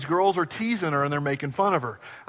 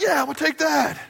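laughing triumphant; 11 semitones higher, 4 dB louder than usual. Retold or imitated. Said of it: "And I was like".

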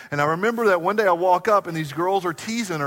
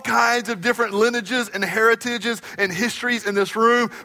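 raised, ringing preacher's voice; full-force preaching; 7 semitones higher, 6 dB louder than usual. Acted. Pastor preaching to the congregation, raised and ringing. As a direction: preach it big to the room.

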